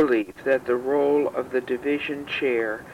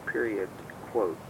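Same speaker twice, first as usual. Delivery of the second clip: dictating; 5 semitones lower, 8 dB quieter than usual. Real, not acted. Dictating punctuation marks.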